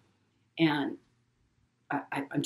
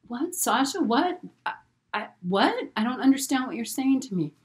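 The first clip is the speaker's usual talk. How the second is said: alarmed confusion; 9 semitones higher than usual. Retold or imitated. Mother confused on phone.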